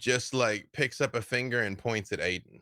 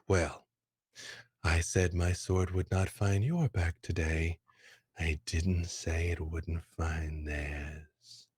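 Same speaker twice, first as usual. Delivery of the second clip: lower, slow threatening murmur; menacing aside; 5 semitones lower, slower than usual. Acted. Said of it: "she leans over and says".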